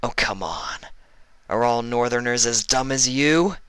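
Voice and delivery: mocking taunt. Vinyl taunting Iceberg to draw him off Fluttershy, mocking. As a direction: needle him with a chuckle. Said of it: "Vinyl said with a chuckle".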